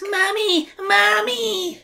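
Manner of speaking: put-on high panicked kid voice; scared child calling. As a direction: cry out for mom in fright.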